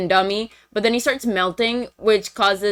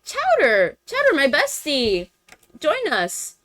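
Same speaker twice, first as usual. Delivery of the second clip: high, cutesy put-on voice; Cinnamoroll's friendly invitation; 6 semitones higher than usual. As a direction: call a bestie over in a pitched-up imitation. Voicing Cinnamoroll inviting Chowder.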